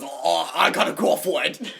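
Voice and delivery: loud, slow, high put-on voice; imitated excited kid. Friend imitating an excited kid's news, loud and drawn out. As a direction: crow it slowly as a mock boast. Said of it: "He goes".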